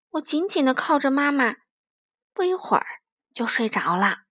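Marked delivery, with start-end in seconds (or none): none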